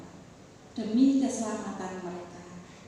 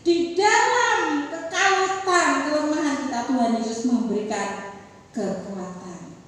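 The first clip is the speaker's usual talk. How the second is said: full-force preaching; 3 semitones higher, 8 dB louder than usual. Acted.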